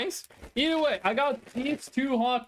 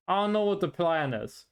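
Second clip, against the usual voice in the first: ordinary delivery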